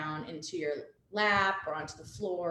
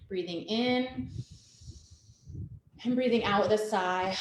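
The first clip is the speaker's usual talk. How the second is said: guided breathing; 3 dB quieter than usual. Acted. Leads breathing exercise.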